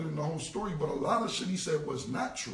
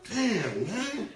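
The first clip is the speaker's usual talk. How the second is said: laughing mock plea; 7 semitones higher, 6 dB louder, much slower than usual. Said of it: "I'll be like".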